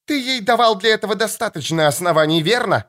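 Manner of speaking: put-on high, shrill-free angry young woman's cry; furious daughter. Daughter retorts.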